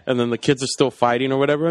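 usual speaking voice

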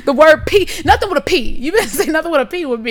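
high, loud comic threat; mock warning. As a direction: play up outrage over parking. Joins the mock parking rant.

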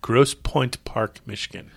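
each word of Grosse Pointe Park drawn out; slow, deliberate. Shown as a demonstration. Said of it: "Why is that so hard to say?".

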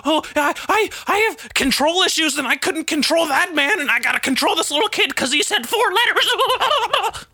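very high squealed mock voice; high-pitched hammed-up meltdown; mocking Karen. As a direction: squeal it hysterically, mocking her. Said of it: "And she was like".

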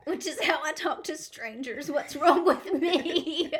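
loud, high, laughing self-mockery. Real, not acted. Friend owns up to talking to strangers, laughing loudly. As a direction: blurt it through big laughter, stretching the end. Laughs at herself over the story.

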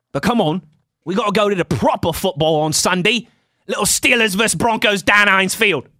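put-on British accent at an easy pitch. Shown as a demonstration. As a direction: talk like a British football fan. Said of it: "I'm not bad at the British accent".

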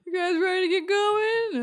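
cheery servant voice. Acted.

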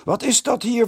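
high, loud outraged call; indignant call. A narrator's reading. The headmaster calls down at the noisy boys, indignant. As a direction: demand an answer from the stairs. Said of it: "riep hij al van de trap".

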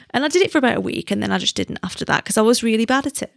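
ordinary talk, nothing marked

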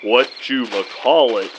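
low, very slow "Whatchamacallit"; showy careful enunciation. Acted. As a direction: mimic him savoring every syllable of "Whatchamacallit". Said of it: "he can say it better than everybody".

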